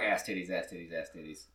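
low, soft robot voice, imitated. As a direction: imitate a text-to-speech voice reading it out. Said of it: "'Cause it was a robot voice saying".

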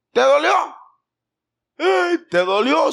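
high, loud sarcastic sympathy; mocking taunt. Parent mocking the disobedient child who fell, loud sarcastic. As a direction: rub it in, hammed-up.

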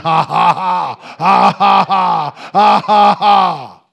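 booming forced belly laugh, very loud; Brother Hagin laughing. Retold or imitated. Preacher acting out a man laughing at the devil, booming staged laughter. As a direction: shout out big defiant ha-ha-has in bursts. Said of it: "Brother Hagin just went".

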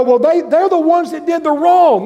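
a mild mock sulk; mock indignant excuse. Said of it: "You go".

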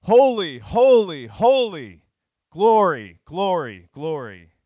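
full-force high shout, each word swooping down; very loud, high acclamation, easing on the last word; angels calling loudly. Acted. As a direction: call it out to another with enormous volume. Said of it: "one called to another and said loudly".

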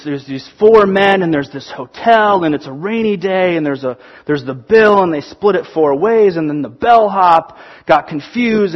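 normal talking voice

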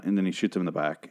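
ordinary delivery

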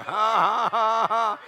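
high, loud forced ha-ha-ha; defiant laughing. Shown as a demonstration. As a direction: punctuate the verse with a big laugh. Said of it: "Let's try that again".

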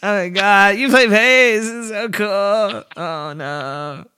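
high, quivering starstruck fanboy; exaggerated gushing, wobbly and drawn out, with giggles breaking in. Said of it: "the way you do that quivering voice is amazing".

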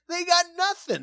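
high, jokey crook voice; burglar voice. Retold or imitated. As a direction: squeak it like a bumbling crook. Quoting Home Alone burglar.

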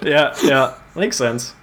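breathless after laughing hard; winded, catching breath between words. Real, not acted. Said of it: "I need to catch my breath".

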